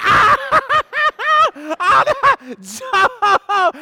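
high loud cackle and shout; laughing yell. Retold or imitated. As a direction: crack up yelling at the friend. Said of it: "We're like".

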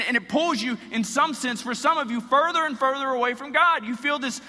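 high, loud, fast impassioned preaching; full-force preaching. Acted. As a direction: pour it out at full intensity.

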